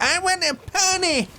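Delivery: put-on high, loud child demanding a pony; bratty child wailing for a pony; whiny child. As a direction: shriek it like a spoiled kid. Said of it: "a child who says".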